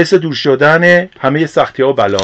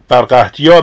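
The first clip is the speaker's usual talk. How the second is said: usual speaking voice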